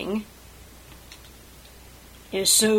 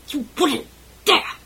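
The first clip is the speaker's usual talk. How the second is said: high, loud cry of defiance; struggling cry; 8 semitones higher, 8 dB louder than usual. A narrator's reading. Sebastian struggling in Caspian's grip, loud high defiant cry. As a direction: yelp it out while struggling. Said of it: "he struggles".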